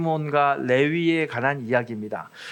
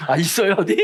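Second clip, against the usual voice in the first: laughing out the question, a bit louder; chuckling as he spots someone in the pews; 3 semitones higher, 5 dB louder than usual. Real, not acted. Pastor laughing as he spots a Chungcheong member, laughing.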